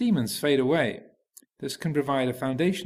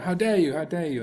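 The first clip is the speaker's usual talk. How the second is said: angry outrage; 4 semitones higher than usual. Acted. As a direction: throw it at the demon. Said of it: "the more angry they got".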